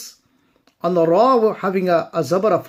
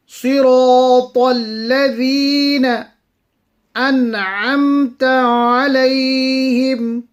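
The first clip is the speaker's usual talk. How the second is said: melodic tajweed recitation, a touch higher; Quran recitation; 6 semitones higher, 5 dB louder, slower than usual. Acted. Teacher reciting the verses for students, melodic and measured. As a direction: chant the verses slowly and evenly.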